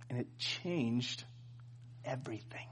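awed hush, slowing to breath; dramatic whisper. Real, not acted. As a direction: drop to a reverent whisper for the payoff. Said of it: "dramatic reveal whisper".

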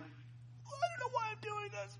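high, very soft, slow, barely voiced; grudging near-whisper of defeat; reluctant whisper. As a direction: give in under your breath. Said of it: "I was like".

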